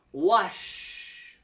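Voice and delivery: long soft sh in Wash, drawn out. Shown as a demonstration. Teacher models Wash for learners, slow soft sh. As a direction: Wash ending in a long, gentle sh sound. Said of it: "Wash, to clean".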